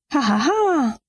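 kurzes hysterisches Lachen. Acted. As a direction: ein unfreiwilliges, hohes Lachen herausplatzen lassen. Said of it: "Wer lacht denn da?". (hysterisches Auflachen)

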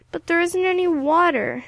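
high, loud invalid's whine; whining protest. A narrator's reading. Sick Ilse protests against the whiskey.

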